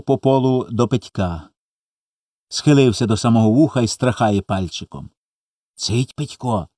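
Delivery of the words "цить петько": softly hushed, a hint of a whisper; secretive whisper. A narrator's reading. Small boy hushing his brother in secret, lightly whispered. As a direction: hush him in secret, softly. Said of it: "потайки шепоче йому".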